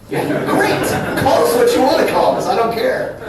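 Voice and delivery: high, loud, exuberant brush-off; emphatic mock reply. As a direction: throw it out loudly to get a laugh. Acted reply to complaining customers.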